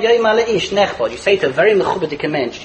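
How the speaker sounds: normal talking voice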